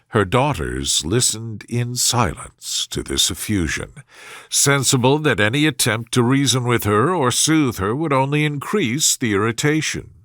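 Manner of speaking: usual speaking voice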